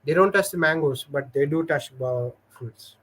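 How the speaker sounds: ordinary delivery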